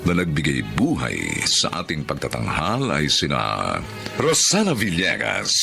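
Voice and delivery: ordinary talk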